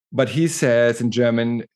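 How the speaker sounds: normal talking voice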